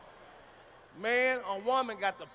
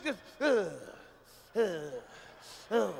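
ordinary delivery

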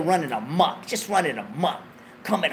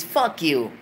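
normal talking voice

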